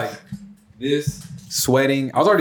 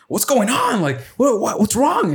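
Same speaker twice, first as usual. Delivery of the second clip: high-pitched imitation of an alarmed mother; mock a mom demanding answers, pitched way up; pissed-off mom; 9 semitones higher, slower than usual. Mom questioning her desperate son, high mock-pissed voice. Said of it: "she was all pissed".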